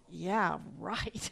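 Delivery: muttered low and quiet, disbelieving; sarcastic thought. Retold or imitated. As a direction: mutter it to yourself, unconvinced. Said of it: "I thought".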